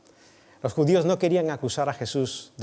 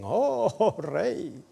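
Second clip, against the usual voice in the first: mock homage; 8 semitones higher than usual. Soldiers mock Jesus as king.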